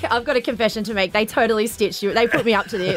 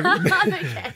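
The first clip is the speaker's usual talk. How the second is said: giggly, high-pitched reassurance; laughing; 8 semitones higher than usual. Real, not acted. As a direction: say it through giggles. Giggling after being asked if okay.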